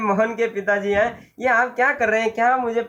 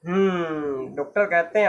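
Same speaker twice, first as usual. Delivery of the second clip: plain reading voice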